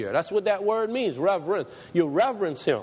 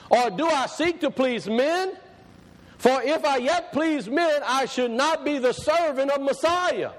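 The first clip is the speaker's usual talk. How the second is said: slow, raised declamatory reading; stretched-out, proclaiming Bible verse; scripture reading; 5 semitones higher than usual. Acted. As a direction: read the verse out slowly and solemnly, pitched up. Rereads scripture aloud to congregation.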